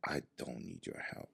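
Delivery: soft, low, whispered command; God answering in a whisper. Retold or imitated. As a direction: hush him, calm and final. Voicing the Lord's answer.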